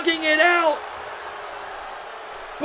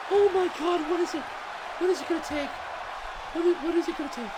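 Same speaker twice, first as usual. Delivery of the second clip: stunned hush; 12 dB quieter, much faster than usual. Real, not acted. Stunned commentator after kickout.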